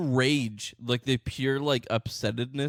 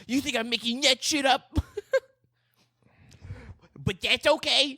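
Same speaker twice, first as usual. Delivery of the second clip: mock ranting short man; 8 semitones higher than usual. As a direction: ham up the furious little man's outrage. Hosts parody the ranting man.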